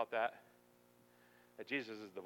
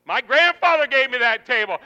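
loud, high-pitched objection; protesting; 11 semitones higher, 21 dB louder than usual. Acted. Congregant defending grandfather's table to God, loud protest. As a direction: protest loudly in defence of the heirloom.

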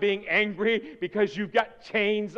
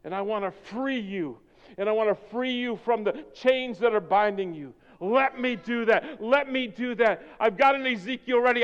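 tender voice of God; 6 semitones higher, 7 dB louder than usual. Acted. Preacher voicing God tenderly promising freedom to the congregation. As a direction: coax them gently as a loving God.

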